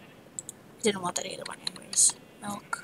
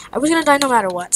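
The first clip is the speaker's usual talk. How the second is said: loud outburst; 6 semitones higher, 15 dB louder, faster than usual. Real, not acted. Frustrated gamer blocked in.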